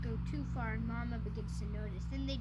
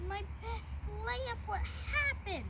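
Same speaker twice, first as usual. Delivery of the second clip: drawn-out lament; 9 semitones higher, slower than usual. Acted. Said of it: "Mama said".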